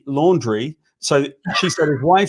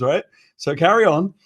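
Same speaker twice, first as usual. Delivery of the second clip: ordinary talk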